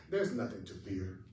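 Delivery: "There's nothing to fear" dropped low and soft; a low, hushed close to the phrase; low, soft inflection. Shown as a demonstration. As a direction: let it sink, quiet and low. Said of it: "just the inflection".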